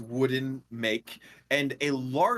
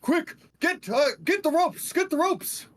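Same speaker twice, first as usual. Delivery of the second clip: higher urgent crewman call; another crew voice; 13 semitones higher than usual. Acted. Sailor urges crew to grab ropes, urgent higher call. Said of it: "hear another voice".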